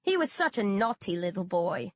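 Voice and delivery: plain reading voice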